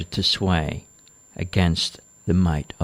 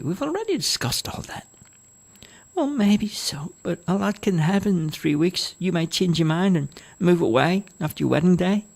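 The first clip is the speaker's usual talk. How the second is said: put-on old mother voice; 11 semitones higher than usual. A narrator's reading. Mother doubts daughter will stay.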